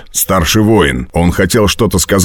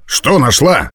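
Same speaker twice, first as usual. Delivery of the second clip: usual speaking voice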